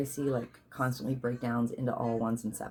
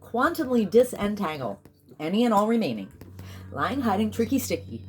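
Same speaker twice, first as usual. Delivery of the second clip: commanding ritual declaration; incantation decree; 4 semitones higher than usual. Real, not acted. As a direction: proclaim it like a spell.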